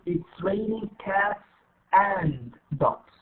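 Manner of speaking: slow, AND said in full as its own word; every word separate, a drawn-out AND between cats and dogs; long form. Shown as a demonstration. Teacher reads the long form first, full slow AND. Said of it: "رح أقرأ أول شيء long form".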